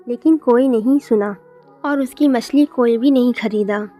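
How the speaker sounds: ordinary delivery, nothing marked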